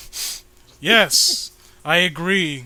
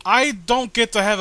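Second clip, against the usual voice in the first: loud, higher, hammed-up I don't give a fuck; playful yelled rant; mock outburst; 4 semitones higher, 5 dB louder than usual. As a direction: blow up in jest. Ranting at co-host.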